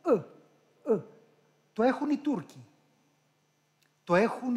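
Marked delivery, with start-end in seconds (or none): 0.1-1.0 "ε" as short central uh sounds; non-Greek vowel. Shown as a demonstration. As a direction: say each "ε" as an isolated foreign schwa. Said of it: "Δεν υπάρχει". («ε» ξένο, κεντρικό φωνήεν)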